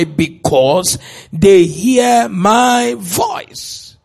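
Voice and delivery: very slow, weighted "hear my voice"; emphatic answer. Real, not acted. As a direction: drive the answer home, drawing out "my voice". Answers own "Why?" with stretched emphasis.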